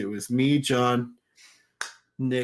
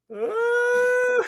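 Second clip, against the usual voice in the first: put-on a loud, high, childish wail; kid's wail; 5 dB louder than usual. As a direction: let out a little kid's startled cry. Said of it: "he just looks at us and goes".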